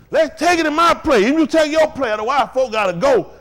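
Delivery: loud, high preaching push; emphatic pulpit delivery. Real, not acted. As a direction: drive it home to the congregation. Preaches with raised force.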